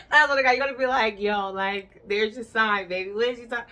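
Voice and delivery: loud high laughing; loud laughter; laughing hard. Real, not acted. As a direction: crack up while saying it. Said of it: "laughing at punchline".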